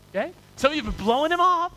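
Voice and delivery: ordinary talk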